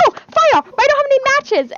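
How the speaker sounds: high, loud mock-panicked cry; panicking Hermione. Fan voicing Hermione in the devil's snare, panicking loudly. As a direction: act out a frantic realisation at full pitch. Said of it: "Hermione's panicking".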